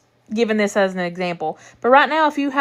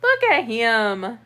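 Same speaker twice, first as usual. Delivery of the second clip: cooing; much slower than usual. Real, not acted. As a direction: coo it slowly at the cat. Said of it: "repeated to cat".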